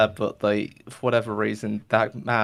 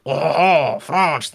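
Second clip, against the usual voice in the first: higher, louder mock-French accent; 4 semitones higher, 5 dB louder, much slower than usual. Shopkeeper switches voices mid-bit, hammed-up French accent. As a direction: throw in a hammy French "oh, oh". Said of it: "he decides to go French".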